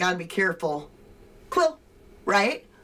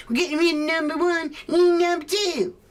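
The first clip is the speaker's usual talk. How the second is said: high, strained mocking yell; mock meltdown; 9 semitones higher, 3 dB louder than usual. Mocking a rival streamer losing his temper, high whiny yell. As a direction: parody a man losing it. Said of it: "this guy over here that's losing his shit going".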